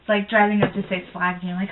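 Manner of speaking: ordinary talk, nothing marked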